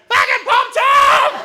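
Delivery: very high, loud, stretched-out scream; panicked scream. Acted. Friends realising their buried mate is in danger, panicked scream. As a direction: scream your friend's name in horror.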